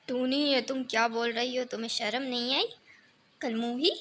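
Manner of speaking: angry scolding mother. Acted. Mother scolds and shames daughter.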